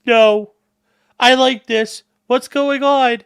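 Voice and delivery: high, loud mock horse reply; voicing a stubborn horse. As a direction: play a horse happily refusing to move. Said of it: "The horse is like".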